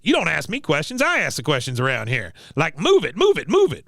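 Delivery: hammed-up bullying orders; mock drill sergeant. Acting Cotton barking orders.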